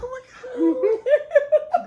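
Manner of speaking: high, slow squeal of delight through a laugh; a drawn-out gasp of joy, high and loud; excited squeal. Retold or imitated. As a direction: squeal it like a thrilled kid. Daughter's excited reaction quoted.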